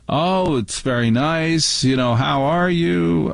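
imitated polite aunt. Aunt Martha greeting the family at dinner, imitated politeness.

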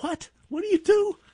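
very high disbelieving squeak; father amazed at the simple answer; incredulous. Retold or imitated.

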